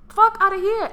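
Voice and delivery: sudden loud, high snap; loud fed-up cry; loud outburst. Real, not acted. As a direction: blast it out, fed up. Angry rant outburst.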